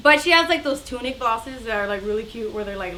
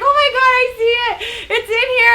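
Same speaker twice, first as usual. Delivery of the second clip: high, loud panicked cry; screaming; 10 semitones higher, 7 dB louder than usual. Retold or imitated. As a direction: imitate a frantic woman screaming from a stall. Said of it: "screaming, right?".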